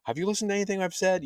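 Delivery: high, quick yell without the volume; mock yell. Jod yelling at the kids in a retold scene, mock yell. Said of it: "like, yelling".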